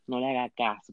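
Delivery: dropped to a soft confiding repeat; quiet aside. Acted. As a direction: murmur it like a secret. Repeated advice, second take.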